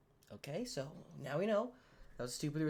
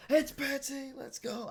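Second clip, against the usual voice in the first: triumphant, much higher and louder; sudden excited realization; excited shout; 10 semitones higher, 4 dB louder, slower than usual. Real, not acted. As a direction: blurt out the answer in triumph. Realizes the Wordle answer.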